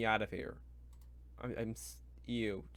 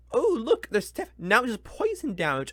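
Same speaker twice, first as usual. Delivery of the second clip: mock excitement; 9 semitones higher, 9 dB louder than usual. Real, not acted. Mocking the book's druid design.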